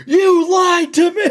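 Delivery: high, loud, slow shout cracking into laughter; hammed-up Chris Farley rage, laughing; mock furious shout. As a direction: bellow it like Farley flipping the table. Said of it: "he flips the table and he goes".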